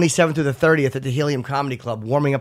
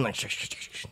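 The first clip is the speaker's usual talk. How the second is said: gentle shush sounds; soft shushing; 4 semitones lower, 16 dB quieter than usual. Retold or imitated. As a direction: hush it softly like soothing an animal. Shushing a bear while petting.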